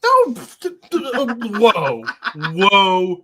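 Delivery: high, loud sputtering objection; flustered protest. Real, not acted. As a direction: sputter in disbelief. Protesting the other's pick.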